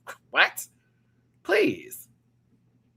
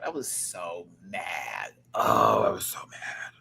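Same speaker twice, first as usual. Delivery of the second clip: 1 teary; 5 semitones lower, slower than usual. Real, not acted. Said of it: "I'm getting teary-eyed".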